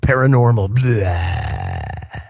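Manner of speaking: hammed-up horror-host announcement; mock spooky. Host unveiling tonight's paranormal topic, mock spooky.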